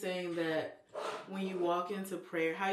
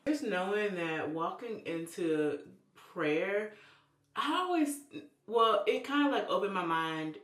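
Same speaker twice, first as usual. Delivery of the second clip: ordinary delivery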